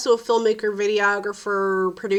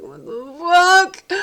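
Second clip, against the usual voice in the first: exasperated; 6 semitones higher, slower than usual. Retold or imitated. Frustration while editing shots.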